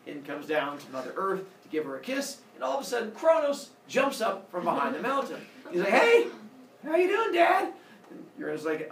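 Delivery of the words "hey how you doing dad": loud, high-pitched ambush greeting; high, booming surprise greeting; mock-cheery shout. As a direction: jump out at Dad with a big hammed-up hello. Son springs out at his father.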